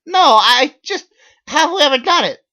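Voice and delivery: squeaky desperate question; nervous pleading. Acted. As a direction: plead anxiously. Narrator presses Megan.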